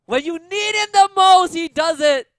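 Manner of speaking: slow, high, loud hype; hype shout. Real, not acted. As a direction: drag it out in awe. Clutch play, caster erupts.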